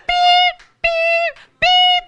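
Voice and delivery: high, loud beeps; high loud imitation of a checkout scanner; mock scanner beeps. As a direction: squeak out the register beeps.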